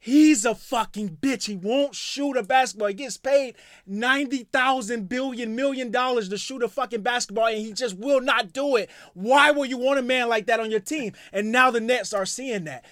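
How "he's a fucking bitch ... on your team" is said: retold rant. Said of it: "I said".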